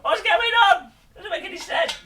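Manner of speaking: very high-pitched bewildered outburst; exasperated squeal. Real, not acted. Builder baffled by the instructions, high exasperated squeal. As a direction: cry it out high, in comic despair.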